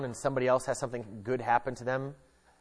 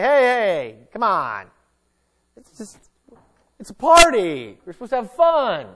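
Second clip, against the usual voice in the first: loud, higher mock partygoer; mock party cheer; 9 semitones higher, 13 dB louder than usual. Preacher mocking friend who shrugs off grief, loud mock cheer.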